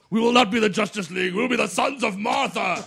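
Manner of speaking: mock hero speech.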